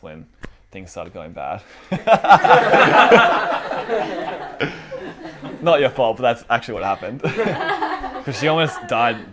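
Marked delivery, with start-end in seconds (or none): 1.7-5.5 loud burst of laughter. Real, not acted. Laughing at own remark. 5.6-7.2 louder words spilling through real laughter; giggling, quick laughing aside. Real, not acted. 7.2-8.2 high squeal of laughter. Real, not acted. Laughing at own remark.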